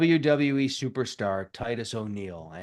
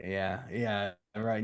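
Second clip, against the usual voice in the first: usual speaking voice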